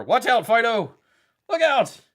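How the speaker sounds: high, loud playful warning shout; mock warning call. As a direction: call out to the dog in mock alarm. Joking warning to cooking show dog.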